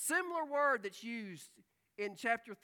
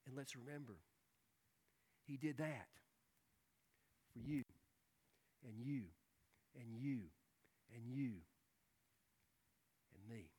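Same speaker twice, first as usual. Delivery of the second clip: hushed reflection; 11 semitones lower, 10 dB quieter than usual. Real, not acted. Preacher softly reminding the congregation Christ did it for them. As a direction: let it sink in quietly.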